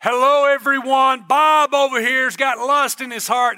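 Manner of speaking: loud, slow, booming call to the whole room; hammed-up God calling someone out; mock public announcement. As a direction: announce it to everyone like a stern herald. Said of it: "isn't it great that God's not going".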